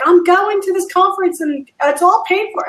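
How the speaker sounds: high-pitched, delighted retelling of a friend; imitating excited news. Retelling a friend's call about the conference, excited.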